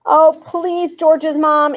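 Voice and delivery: put-on a girl pleading in a rush; bursting out. A narrator's reading. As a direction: blurt it out, pleading. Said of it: "Annie burst out".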